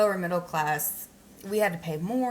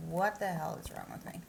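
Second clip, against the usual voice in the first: ordinary talk, nothing marked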